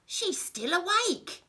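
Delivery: high, put-out villain voice; Len the crook. A narrator's reading. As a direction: complain it in a high character voice. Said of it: "said Len and frowned".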